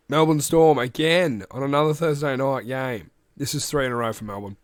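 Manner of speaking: usual speaking voice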